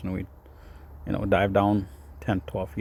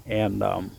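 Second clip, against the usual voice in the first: ordinary talk, nothing marked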